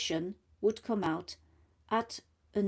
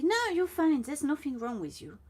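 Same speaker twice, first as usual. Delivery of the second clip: mock-reassuring high voice, louder at first; dismissive friend; 7 semitones higher, 3 dB louder than usual. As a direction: brush off the worry, higher and louder. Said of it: "they say".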